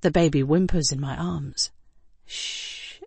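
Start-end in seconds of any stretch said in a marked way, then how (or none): 2.3-3.0 unvoiced, hushed shush; soft shush. Woman hushing a whimpering baby in her arms. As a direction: soothe the baby with a hush. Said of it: "I say in a soft voice".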